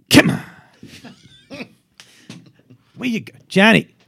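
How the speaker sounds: high, loud impatient grandpa yelling at a driver; escalating comic road-rage yell in an old man's voice; angry old Italian man. Acted. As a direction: shout it like a hammed-up furious old Italian grandfather. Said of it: "this angry old Italian man".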